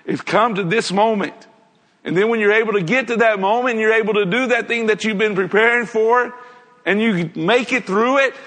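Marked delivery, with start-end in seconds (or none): none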